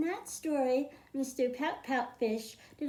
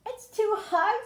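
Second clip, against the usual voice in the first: despairing; 10 semitones higher, 5 dB louder than usual. Acted. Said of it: "his voice close to despair".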